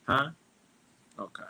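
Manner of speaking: ordinary talk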